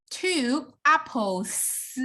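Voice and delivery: two apples drawn out slowly, stress on two; teacher models the English plural slowly for students; slow example. Shown as a demonstration. Slow, clear teaching example. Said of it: "You guys will say".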